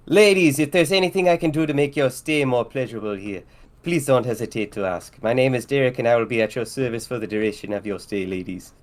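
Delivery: put-on Jamaican dockhand; thick Jamaican accent. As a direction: greet the guests in a hammed-up Jamaican accent. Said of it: "with a thick Jamaican accent".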